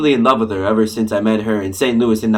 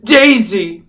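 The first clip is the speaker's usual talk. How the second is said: overjoyed cry; 7 semitones higher, 6 dB louder than usual. Acted. Gatsby sees Daisy at last.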